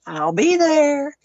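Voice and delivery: high, drawn-out phone sign-off; lilting, slow retold assurance; retold cheery promise. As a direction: stretch it out high, imitating a friend. Retelling friend's last phone call.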